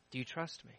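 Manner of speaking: very soft repeat, rising at the end; hushed God voice. Acted. God asks the widow again, barely above a breath. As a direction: let it fall to almost nothing, ending on a lift.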